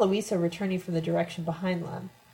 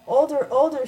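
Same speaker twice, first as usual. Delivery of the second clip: high, loud cry in narration; screamed retort; 7 semitones higher, 7 dB louder than usual. A narrator's reading. Mother racing her to the corner, screamed. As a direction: scream it back as a taunt, without full force. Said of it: "she screamed".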